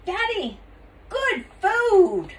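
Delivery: put-on high little-girl voice. A narrator's reading. Taya shows her father the candy, little-girl voice. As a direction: pipe it high like a small child.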